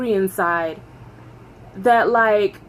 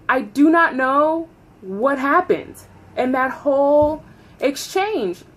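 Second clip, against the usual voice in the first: ordinary delivery, nothing marked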